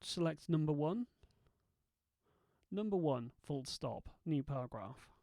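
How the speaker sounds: dictation commands. Shown as a demonstration. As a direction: dictate each word clearly to software. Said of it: "edit this with nothing more than my voice".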